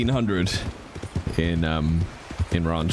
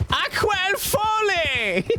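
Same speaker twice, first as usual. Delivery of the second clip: shout; much slower than usual. Acted. Shouted character name.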